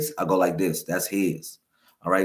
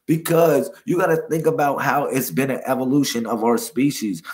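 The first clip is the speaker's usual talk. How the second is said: ordinary talk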